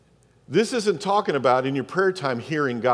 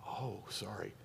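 very soft, low mock apology; retold apology, shrinking almost to nothing; sheepish apology; 8 semitones lower, 22 dB quieter than usual. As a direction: say it tiny and sheepish. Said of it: "And I went".

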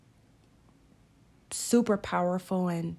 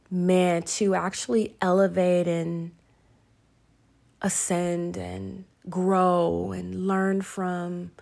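ordinary delivery

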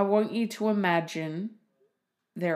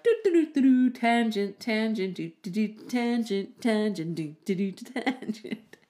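sing-song "do do do" with tangents popping up higher; scatterbrained brain; 4 semitones higher than usual. Acted. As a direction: act out a brain bouncing from tangent to tangent. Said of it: "That's my brain just like".

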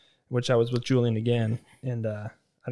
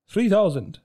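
high, loud price call; shopkeeper price; 7 semitones higher, 7 dB louder than usual. Retold or imitated. Shopkeeper naming his price, higher and louder. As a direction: announce the price proudly. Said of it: "He's like".

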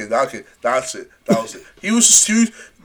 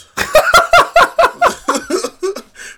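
long, high, loud cackle; helpless high laugh; high burst of laughter; 8 dB louder than usual. Real, not acted. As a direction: crack up at the meme. Laughing at a meme.

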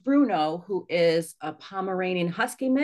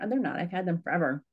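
ordinary delivery

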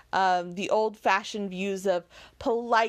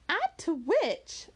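eager cry; 9 semitones higher, slower than usual. A narrator's reading. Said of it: "cried Marianne".

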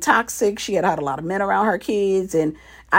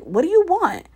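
annoyed question; 10 semitones higher than usual. Retold or imitated. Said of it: "And I was like".

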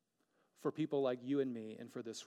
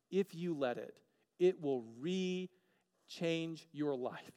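higher, slowed promise; slow emphasis; 3 semitones higher, slower than usual. Real, not acted. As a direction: lean on each word of the promise. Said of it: "And if you let it".

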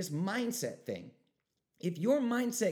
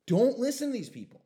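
emphatic warning; 7 semitones higher, 8 dB louder than usual. Real, not acted. Urgent plea to listeners.

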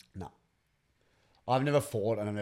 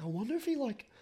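high voice; 3 dB quieter than usual. Retold or imitated. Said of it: "I was like".

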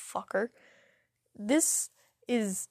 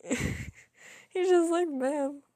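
laughing; 7 semitones higher, much slower than usual. Real, not acted. Laughing retelling guard.

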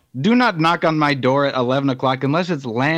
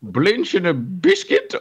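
Goldmember/character; 4 semitones higher, slower than usual. Retold or imitated. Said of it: "Like Gold, Gold member?".

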